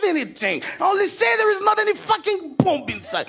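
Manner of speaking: high, angry English shout; high irritated shout; annoyed. Retold or imitated. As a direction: let the annoyance burst out. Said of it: "j'ai été énervé".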